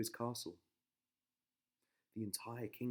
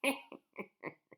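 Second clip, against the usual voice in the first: high breathless witch cackle; high airy crone laugh; sinister cackle; 5 dB louder than usual. Acted. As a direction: cackle with evil glee. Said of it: "with a sinister laugh".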